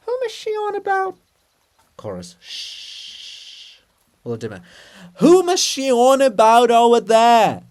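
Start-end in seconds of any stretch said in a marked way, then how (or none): 0.1-1.2 very high, far-off call; distant giant voice. Acted. Volodymyr's monument calling from afar, very high distant voice. 2.4-3.8 whispered shh; hushing. Acted. Chorus hushing Volodymyr, whispered shh. 5.2-7.6 very high, far-off call; distant giant voice. Acted. Volodymyr's monument calling from afar, very high distant voice.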